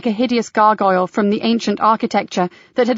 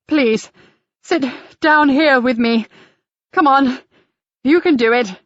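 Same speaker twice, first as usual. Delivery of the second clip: coaxing plea; 4 semitones higher than usual. Acted. Guide coaxing panicking man.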